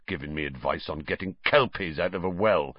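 growled. Acted. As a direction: grumble with contempt. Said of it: "growled Hagrid".

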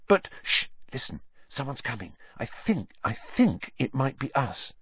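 soft urgent whisper; hushed whisper. A narrator's reading. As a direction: hush so no one hears. Hiding in cupboard, hushing Harry.

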